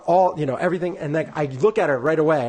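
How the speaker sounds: normal talking voice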